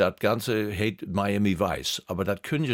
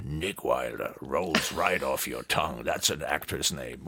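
lower, softer, savoring the name; lower, slow, softer American pitch; smooth promoter voice; 8 semitones lower, 5 dB quieter, slower than usual. Retold or imitated. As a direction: sell the name like a Hollywood agent. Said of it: "Und dann sag ich".

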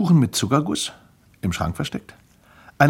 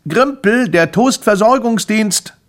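high, loud call through a letterbox; 11 semitones higher, 7 dB louder, slower than usual. A narrator's reading. Grimpel calls through the letterbox, loud high announcing call. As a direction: call out to someone behind a closed door. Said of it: "rief er durch den Briefkastenschlitz".

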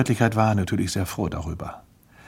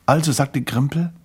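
usual speaking voice

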